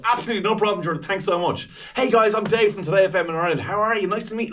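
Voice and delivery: high, loud, bubbly patter; high-energy introduction. Acted. Interviewer introducing himself to stars on Zoom, high and bubbly. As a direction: launch in with full upbeat energy. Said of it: "I'm giving that".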